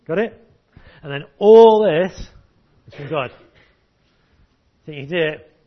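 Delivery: normal talking voice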